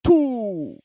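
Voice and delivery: high drawn-out Count voice; the Count saying two. Retold or imitated. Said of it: "the way he says two is real funny".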